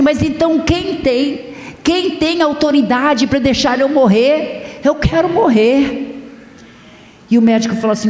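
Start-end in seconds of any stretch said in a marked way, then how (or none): 0.0-6.1 high, pleading voice breaking into tears; crying plea. Retold or imitated. Wounded soldier begging the doctor, tearful high plea. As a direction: beg to die through tears, pitched high. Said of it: "começou a chorar".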